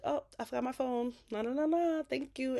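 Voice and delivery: high, airy feigned nonchalance; playing it off smooth. Fatima fetching her phone after eavesdropping, playing it off smooth. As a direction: act breezily innocent, pitched well up. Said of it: "She played it off smooth".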